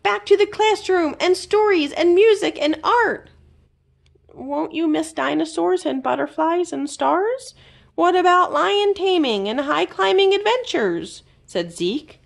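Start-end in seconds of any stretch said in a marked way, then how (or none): 0.0-3.3 joyful cry. A narrator's reading. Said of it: "he cried". 4.3-11.2 high, tempting wheedle; little creature pleading with a friend; Zeke coaxing. A narrator's reading.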